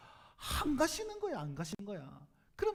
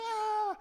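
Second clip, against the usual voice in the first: high thrilled shriek of a fan; fan scream; 10 semitones higher, 4 dB louder than usual. Retold or imitated. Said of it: "소리 지르면서".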